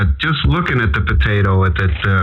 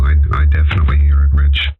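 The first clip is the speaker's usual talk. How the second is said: lower, fast and deep, showing off the bass; 7 semitones lower, faster than usual. Shown as a demonstration. Host replying to Rich, dropping his voice low to show the bass. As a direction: drop into the chest to show the lows. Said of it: "you got it too when you talk like that".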